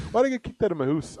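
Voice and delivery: put-on quick, comic Scottish-tinged accent; kinda Scottish accent. Host testing their own accent, quick and kinda Scottish. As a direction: rattle it off with a Scottish "hoose" for house. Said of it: "I suppose that is kinda Scottish".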